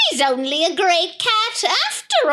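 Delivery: high, scornful creature voice; mocking creature. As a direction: sneer like a jeering monster. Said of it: "cried one".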